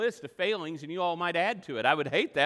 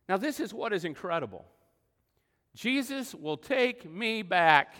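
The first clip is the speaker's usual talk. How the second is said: usual speaking voice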